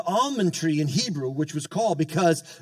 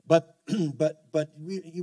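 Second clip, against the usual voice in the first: ordinary delivery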